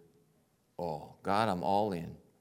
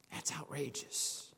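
whispered aside; 4 semitones higher, 11 dB quieter than usual. Real, not acted. Awed aside about Abraham's faith.

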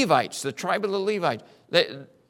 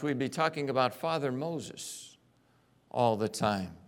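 ordinary talk, nothing marked

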